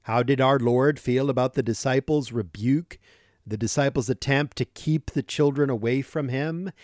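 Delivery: ordinary talk, nothing marked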